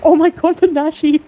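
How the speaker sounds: high, loud burst of delight; thrilled gasp. Real, not acted. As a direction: gush like a fan spotting a treat. Thrilled by the Nashi sandwich.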